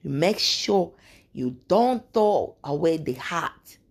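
lightly voiced African elder giving advice; African accent. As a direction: pass on a secret recipe with an African lilt. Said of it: "And she said".

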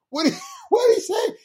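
high squealed, laughing double-take; shocked disbelief. Retold or imitated. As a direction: stammer it in mock shock through laughter. Retold shocked congregation reaction.

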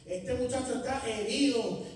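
loud, rising preacher's fire; full-force preaching. Acted. Preacher telling the congregation his testimony, at full force. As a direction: preach it out, building heat.

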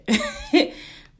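loud high laugh breaking out; burst of laughter. Real, not acted. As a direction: let a big laugh burst out. Laughs at own joke.

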